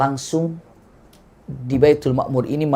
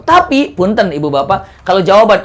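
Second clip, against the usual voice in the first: ordinary delivery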